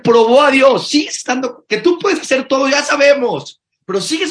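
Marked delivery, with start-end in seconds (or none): none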